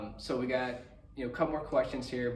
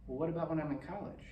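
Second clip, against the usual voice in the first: lightly voiced, quicker child's question; innocent kid question; 5 semitones higher, faster than usual. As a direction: ask like an earnest little boy. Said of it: "He's like".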